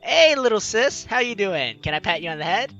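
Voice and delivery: usual speaking voice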